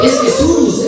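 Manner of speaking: high-pitched exclamation, falling at the end; emphatic reveal. Acted. Answering the Argentine who the player is, emphatic. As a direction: announce it as if astonished. Awed reply about the player.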